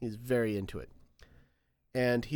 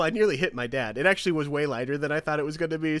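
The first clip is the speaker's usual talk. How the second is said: ordinary talk, nothing marked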